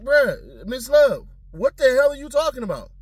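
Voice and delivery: high-pitched mock outrage at the prosecutor; exasperated defendant. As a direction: demand an answer in disbelief. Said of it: "And it's like".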